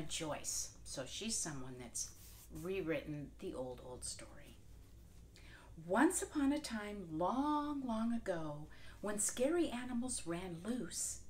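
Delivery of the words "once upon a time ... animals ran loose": big, bright once-upon-a-time telling; lively read-aloud to children; storybook narration. A narrator's reading. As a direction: open the tale with a grand flourish. Storybook opening.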